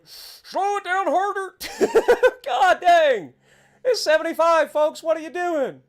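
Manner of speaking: high, loud yell breaking into laughter; exasperated shout. Real, not acted. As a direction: holler in laughing disbelief at the road. Reacting to sudden need to brake.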